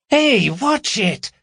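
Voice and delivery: high, drawn-out complaint; complaining. A narrator's reading. Carol with torch shone in her face, complaining. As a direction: protest slowly in a high voice. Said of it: "she complained".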